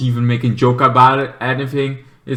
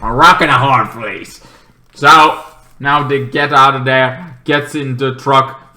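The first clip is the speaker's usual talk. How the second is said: normal talking voice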